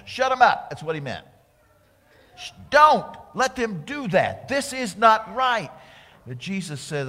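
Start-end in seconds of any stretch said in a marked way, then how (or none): 0.1-0.7 high, loud, scornful snap; indignant demand. Retold or imitated. Pharisees' demand paraphrased. 2.4-5.7 high, loud, outraged protest; indignant protest. Retold or imitated. Pharisees voiced, protesting.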